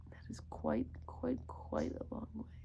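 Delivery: soft whisper, lingering on quite; awed whispered aside. Real, not acted. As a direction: hush it like a secret. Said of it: "hushed emphasis".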